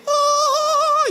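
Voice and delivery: high, drawn-out mock trance howl; exaggerated high "ohhh" of someone in a trance; trance wail. Preacher parodying people who serve God in a trance, high mock wail. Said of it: "entras en estado de trance".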